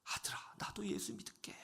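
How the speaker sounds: a weeping father confessing to his son; hushed with tears; tearful whisper. Retold or imitated. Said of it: "눈물을 흘리면서".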